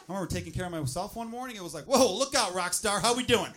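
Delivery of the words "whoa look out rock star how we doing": loud, high whoop of admiration; impressed hype. Retold or imitated. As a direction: hype up a rock star, voice raised. Acted self-congratulation in the morning.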